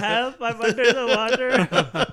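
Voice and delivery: put-on high, slow Pakistani accent through laughter. Quoting the underwater meme, laughing.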